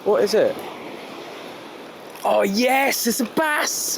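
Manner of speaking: sudden thrilled discovery, voice jumps high; excited. Real, not acted. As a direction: cry out the catch in delight. Seeing the catch land.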